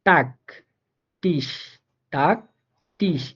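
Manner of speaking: টাক and টিস said with a crisp Bangla ট at the start; German Tag and Tisch with a clear T. Shown as a demonstration. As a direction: say টাক, টিস with a hard ট sound. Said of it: "টেটা ট এর মতোই উচ্চারিত হয়".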